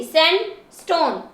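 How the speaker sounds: Sandstone as sand, then stone; syllable by syllable. Shown as a demonstration. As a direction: separate the two parts of sandstone. Teacher splits word into syllables.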